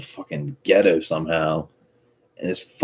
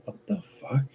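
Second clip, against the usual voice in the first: mock shock; 11 semitones higher, 9 dB quieter than usual. Reacting to maids and chauffeurs.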